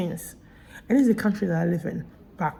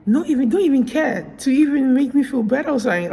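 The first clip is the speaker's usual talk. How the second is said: higher and louder, fed up; exasperated rant; 7 semitones higher, 6 dB louder than usual. Real, not acted. Venting to viewers about the uncaring staff, exasperated. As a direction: let the frustration spill over.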